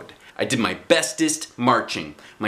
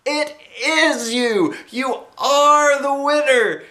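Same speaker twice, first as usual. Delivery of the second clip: high, slow, loud cheering; excited yell; 4 dB louder, much slower than usual. A narrator's reading. Mother cheering Junie B's win, high and slow. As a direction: cheer her on with relief. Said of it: "she yelled".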